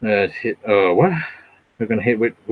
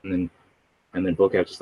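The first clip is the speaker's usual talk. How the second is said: ordinary talk, nothing marked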